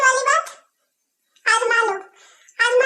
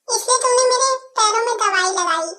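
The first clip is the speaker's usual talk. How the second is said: normal talking voice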